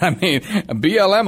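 usual speaking voice

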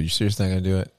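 ordinary delivery

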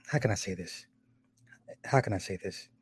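very soft, low murmur; musing to oneself. Real, not acted. Vlogger searching for how to phrase criticism, hushed musing. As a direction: think aloud under your breath. Searching for tactful wording, to self.

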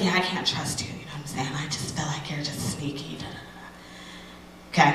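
soft, half-whispered brush-off; mock breakup mumble. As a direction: toss it off under your breath like the ex. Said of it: "He was like".

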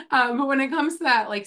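ordinary delivery

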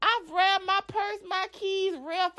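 normal talking voice

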